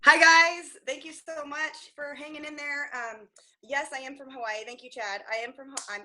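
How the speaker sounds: ordinary talk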